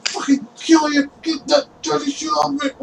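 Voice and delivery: high, strained protest; barely able to talk. Acted. Pinned man to the guard standing on him, strained angry protest. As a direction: squeeze the threat out from under a weight. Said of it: "he's barely able to talk".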